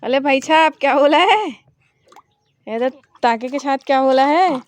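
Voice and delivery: put-on loud, high baby talk; put-on childish lisp; lisping toddler. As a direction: play a lisping little kid, loud and cheeky.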